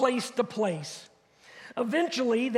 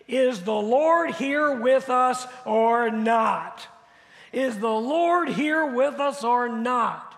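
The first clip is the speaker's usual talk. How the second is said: slow, emphatic group recitation; reading together; 4 dB louder, slower than usual. Shown as a demonstration. Preacher leads congregation in the last verse, reading together. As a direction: lead the room word by word, deliberately. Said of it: "let's read this last piece together".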